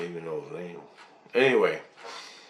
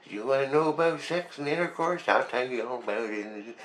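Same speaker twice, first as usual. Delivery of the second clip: sly grandpa; 3 semitones higher, 5 dB quieter than usual. Retold or imitated. Christ teasing the speaker about sex, a hint of a sly grandpa. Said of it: "And he goes".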